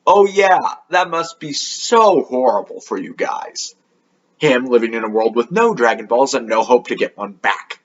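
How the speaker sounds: mock sympathy, leaning hard on the opening; sarcastic commiseration, easing down as it goes. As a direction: lay on the fake pity. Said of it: "After Trunks sarcastically says".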